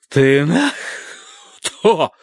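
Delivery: put-on drunk old man, voice lurching in volume; slurring drunk. A narrator's reading. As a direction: slur it, pitch jumping up. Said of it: "голос его менял громкость в диапазоне пяти регистров".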